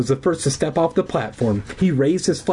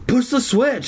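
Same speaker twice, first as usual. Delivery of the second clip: urgent cry; 12 semitones higher than usual. A narrator's reading. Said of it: "Zeke cried to me".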